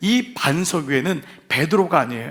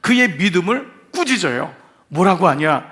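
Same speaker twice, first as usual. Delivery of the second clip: ordinary talk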